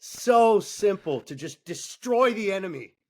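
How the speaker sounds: excited disbelief. Real, not acted. Mentor marveling that beating the enemy is so simple, excited.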